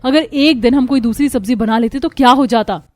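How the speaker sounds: loud, fed-up grumbling; angry complaint. Acted. Daughter-in-law fumes over the vegetables, loud and angry. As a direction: vent your anger, loudly. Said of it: "दोनों बहुएं रसोई में गुस्से में".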